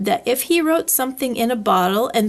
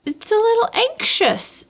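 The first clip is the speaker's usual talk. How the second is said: much higher, small worried voice; a tiny animal fretting to himself; anxious Piglet; 11 semitones higher than usual. Acted. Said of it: "he said to himself".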